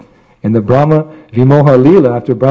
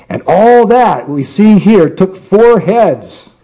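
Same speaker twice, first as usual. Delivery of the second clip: amazed emphasis; 7 semitones higher, slower than usual. Real, not acted. Marvelling at Brahma's creation.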